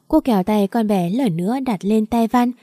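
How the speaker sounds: ordinary delivery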